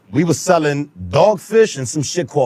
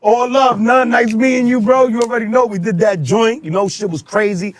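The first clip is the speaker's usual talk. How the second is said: friendly goodbye; 7 semitones higher than usual. Retold or imitated. [name]'s goodbye.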